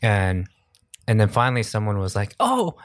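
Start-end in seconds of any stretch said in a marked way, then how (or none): 2.4-2.8 squeaky high excited "oh great job"; imitating a fan cheering; high mock fan praise. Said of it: "or something in Korean".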